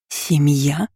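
hoarse, disbelieving question. A narrator's reading. Girl questioning these strangers as family, hoarse. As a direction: croak it with a rising end. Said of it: "прохрипела в ответ".

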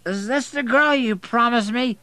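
put-on high, loud slurring drunk; sloppy drunken demand; incoherent drunk. A narrator's reading. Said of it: "he's nearly incoherent".

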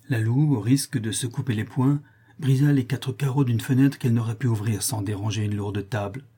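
ordinary delivery, nothing marked

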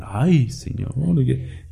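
a hint of a worshipful bystander; mock awed admirer.

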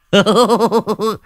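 high, smug laugh; boastful cackle. Acted. Old man laughing at his ghost-catching plan, smug cackle. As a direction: cackle at your own idea.

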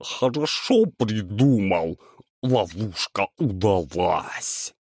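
slow gurgling chorus of monsters; gurgling alien voice. A narrator's reading. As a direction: gurgle it like swamp creatures. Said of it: "пробулькали все в один голос".

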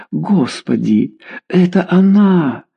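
slow, rising gasp of recognition; exhaled relief. A narrator's reading. Mother recognising her daughter's voice, relieved exclamation. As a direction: breathe it out, climbing high. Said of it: "выдохнула Клавдия".

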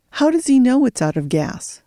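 put-on girl's challenge. A narrator's reading. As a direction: catch him out.

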